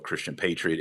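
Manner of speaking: usual speaking voice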